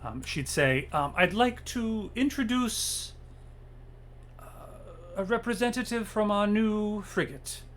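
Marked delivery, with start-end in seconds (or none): 1.2-7.6 formal introduction. Acted. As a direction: present the guest with ceremony.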